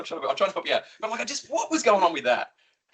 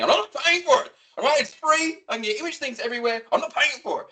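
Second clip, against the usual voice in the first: higher, indignant rant; exasperated rant; 9 semitones higher than usual. Real, not acted. Ranting to his co-host about ChatGPT, exasperated and high. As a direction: refuse it, exasperated.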